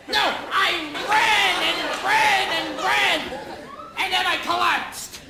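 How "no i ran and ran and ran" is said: long, heavily leaned-on "ran" each time; emphatic insistence. Acted. Runner correcting an understating listener, stretched-out "ran". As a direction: correct them by dragging out every "ran".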